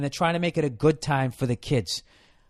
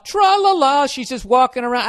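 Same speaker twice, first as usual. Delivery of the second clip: high, louder sing-song mock; mock carefree girl; 8 semitones higher, 5 dB louder than usual. Comic mocking Tarzan's girlfriend, high sing-song. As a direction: prance through it like a clueless jungle girlfriend.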